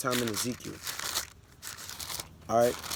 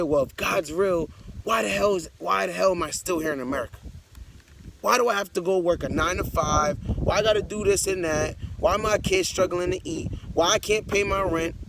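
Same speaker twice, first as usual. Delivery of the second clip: acted complaint; 4 semitones higher than usual. Acted complaint of struggling people.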